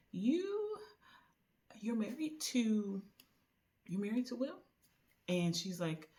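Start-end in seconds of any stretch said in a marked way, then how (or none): 0.1-4.6 snide disbelief. Retold or imitated. Ex-employee mocks the fiancée in the bathroom, high and snide.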